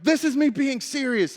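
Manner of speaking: high-pitched, offended retort; indignant mock anger. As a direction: insist like a pranked guy losing patience. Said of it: "getting really angry and really frustrated".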